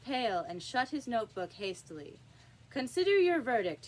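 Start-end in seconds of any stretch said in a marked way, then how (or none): none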